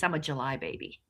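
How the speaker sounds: ordinary delivery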